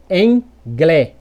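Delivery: angle as "englé", slowly, open final E; read as Portuguese. Shown as a demonstration. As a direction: end slowly on an open "glé". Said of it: "em português".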